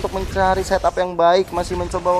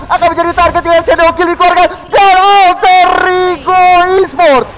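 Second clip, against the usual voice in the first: loud, high victory call; esports caster; 9 semitones higher, 8 dB louder than usual. Acted. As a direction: call the win at full hype. Hyped caster call, game end.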